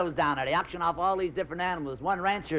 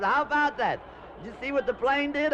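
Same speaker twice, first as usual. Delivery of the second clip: higher, excited hostess voice; hostess amazed; 7 semitones higher than usual. Acted. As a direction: gush about the plane. Hostess marvels at the plane.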